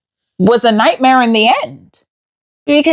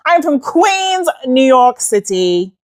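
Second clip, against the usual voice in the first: usual speaking voice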